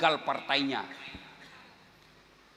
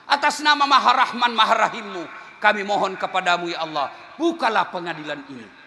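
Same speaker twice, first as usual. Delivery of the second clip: loud pleading prayer; 6 semitones higher, 7 dB louder than usual. Acted. Prophet pleading to Allah.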